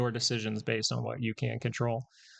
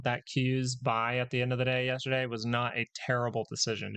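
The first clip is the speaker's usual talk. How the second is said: ordinary talk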